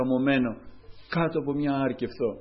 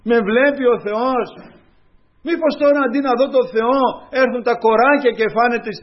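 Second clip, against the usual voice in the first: high, loud fervent sermon; preaching at full force; 7 semitones higher, 8 dB louder than usual. Real, not acted. Preacher answering the despairing believer, fervent. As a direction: call out to the doubting believer.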